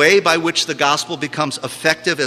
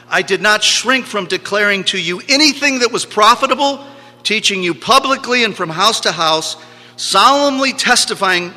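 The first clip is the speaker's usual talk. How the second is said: raised, ringing scripture reading; emphatic preacher's quotation, higher and forceful; declaimed scripture; 7 semitones higher, 4 dB louder than usual. Real, not acted. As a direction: proclaim the verse, pitched high.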